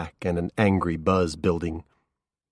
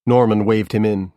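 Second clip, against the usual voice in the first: plain reading voice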